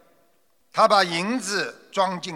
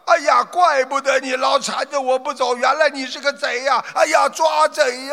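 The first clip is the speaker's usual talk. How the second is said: higher and louder, ending in a shout; loud yelling; 9 semitones higher, 6 dB louder than usual. Acted. Robbed merchant leaps up, shouting thief. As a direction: cry out for someone to catch the thief. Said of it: "大声骂道".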